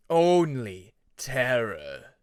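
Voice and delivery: very slow, loud threat; menacing dragon. Acted. Shadow dragon closing in on Peacemaker, slow and loud. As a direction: boom it out slowly.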